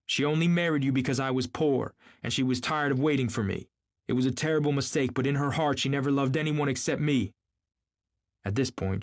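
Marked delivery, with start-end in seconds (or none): 0.1-7.3 high, urgent insistence; excited outburst. A narrator's reading. Gatsby declaring Daisy's love to Tom, excited outburst. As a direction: pour it out with rising conviction, pitched up. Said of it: "vivid with excitement".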